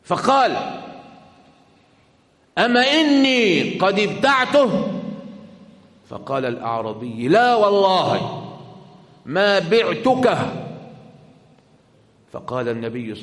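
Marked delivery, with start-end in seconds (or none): 2.6-5.0 loud, high, drawn-out challenge; slow, raised voice disputing a sale; acted protest. Acted dispute over horse sale. 7.3-10.4 slow, loud, raised denial; high, forceful refusal in a retold dispute; acted denial.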